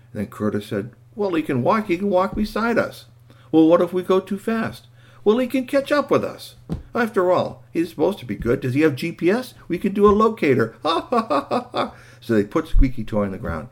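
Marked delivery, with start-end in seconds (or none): 1.1-11.9 put-on high, lively boy's voice, ending in a laugh; boy in a story. Boy reasoning that the robot can keep up, eager. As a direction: talk it up high, then laugh.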